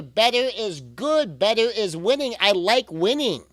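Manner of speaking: rally the listener like a coach; a pep-talk chant with a slow, punchy start; upbeat cheer. Real, not acted. Cheering on a struggling parent.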